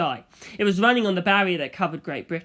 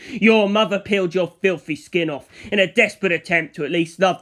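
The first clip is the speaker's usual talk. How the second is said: cruel berating; 6 dB louder than usual. Acted. Dio berating Antonio about his mother, cruelly. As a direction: tear him apart with scorn.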